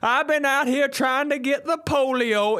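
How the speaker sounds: high, goofy dumb-guy voice; dopey guy voice. Retold or imitated. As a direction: play a clueless yokel proudly bragging.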